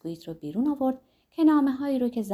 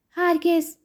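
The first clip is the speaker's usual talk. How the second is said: sharp, higher, louder retort; sharply; 8 semitones higher, 6 dB louder, slower than usual. A narrator's reading. Emma sharply refusing Rodolphe's warning, higher and louder. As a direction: snap it out in refusal. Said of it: "اما به تندی گفت".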